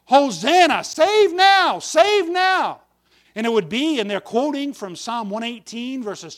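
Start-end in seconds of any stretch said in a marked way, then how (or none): 0.1-2.8 loud, high cry of praise; crowd crying out. Retold or imitated. Preacher voices the crowd going forth to meet him, crying out. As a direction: call it out high and strong, like a welcoming crowd.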